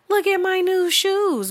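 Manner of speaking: high-pitched mimicking show-off; mock a poster showing off in a squeaky voice; high, singsong parody; attention seeker. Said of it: "the bitches who, like, post shit like".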